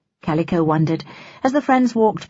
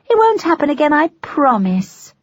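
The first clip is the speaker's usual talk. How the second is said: cooing; 9 semitones higher, 4 dB louder than usual. Acted. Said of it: "she cooed".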